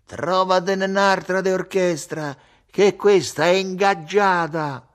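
loud, high call with a drawn-out end; shouting. A narrator's reading. Ugo shouts back from the door at the man, shouting. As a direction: call out loudly across a distance. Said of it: "e gridò".

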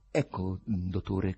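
usual speaking voice

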